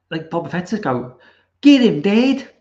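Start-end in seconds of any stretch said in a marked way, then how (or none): 1.6-2.5 loud, higher New Zealand caricature easing off by the end; hammed-up New Zealand tough guy impersonation; mock New Zealand accent. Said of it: "he's got this, like, z-, z-, New Zealand".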